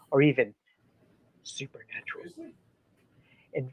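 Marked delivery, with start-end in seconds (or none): 1.4-2.3 eerie whispered word. Pretend. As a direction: breathe it like a ghost story. Spooky word in ghost talk.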